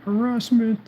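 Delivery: high mock yell of harassment, in a put-on voice; mock scream. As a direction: shriek it like a whiny seller. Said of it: "turn around and scream".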